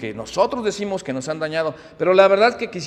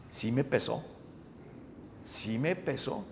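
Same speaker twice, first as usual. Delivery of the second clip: heavy quiet; 7 semitones lower, 12 dB quieter, slower than usual. Real, not acted. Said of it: "por quien me lo dijo".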